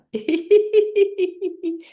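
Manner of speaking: high staccato giggle; fake laughter. Shown as a demonstration. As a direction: tap out high laughing notes like raindrops. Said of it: "You can have them soft or".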